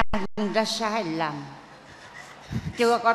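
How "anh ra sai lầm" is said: exhausted. Acted. Exhausted groom groans.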